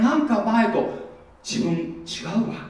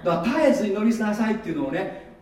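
ordinary talk, nothing marked

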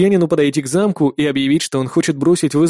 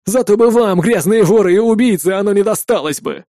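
loud, high, angry defiance; stubborn defiance; 5 semitones higher, 3 dB louder than usual. A narrator's reading. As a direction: hurl it at the thieves defiantly. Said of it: "упрямствовал Арви". (упрямо и громко, со злостью)

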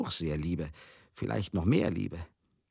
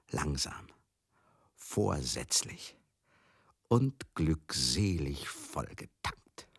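drawn out slowly, word by word; slow and savoured; 3 semitones lower, much slower than usual. Acted. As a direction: stretch each word out with relish. Relishing the memory of drinking. (langsam und genüsslich gedehnt)

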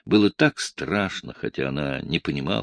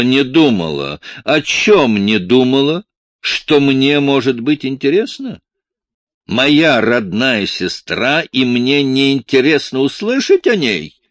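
loud, higher mocking tirade, slow; loud scornful rant at the girl; mocking anger; 7 semitones higher, 8 dB louder than usual. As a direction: fling her words back at her with scorn. Man mocks her excuse.

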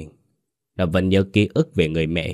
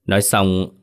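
plain reading voice

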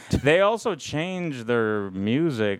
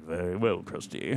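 lightly voiced low villain; a hint of a haughty villain; Sideshow Bob voice; 7 semitones lower, 8 dB quieter than usual. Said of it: "he's like".

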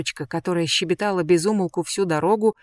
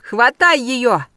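loud, higher, slow shout; 6 semitones higher, 7 dB louder, much slower than usual. A narrator's reading. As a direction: yell the order at him. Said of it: "и крикнула".

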